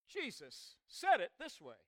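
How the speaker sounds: ordinary delivery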